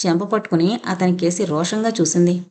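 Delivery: ordinary delivery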